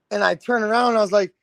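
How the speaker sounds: ordinary talk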